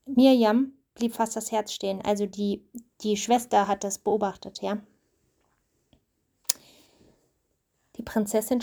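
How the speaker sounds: steady storybook reading voice; reading to a child. A narrator's reading. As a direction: read the story aloud to your child. Mother reads Bible story aloud.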